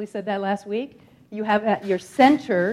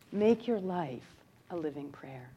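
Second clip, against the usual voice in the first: guided meditation; 5 semitones lower, 11 dB quieter than usual. Acted. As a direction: ease the listeners into meditation.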